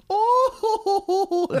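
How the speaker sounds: very high, loud effeminate cackle; shrill "oh-ho-ho" laugh in falsetto; Frieza impression. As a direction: imitate an effeminate villain's long hooting laugh. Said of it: "an insanely effeminate dude. He's like".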